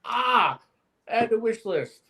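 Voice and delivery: high mock-dreamy sigh at a pricey Colt; dreamy longing. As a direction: swoon over the gun you can't afford. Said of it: "And I'm just like".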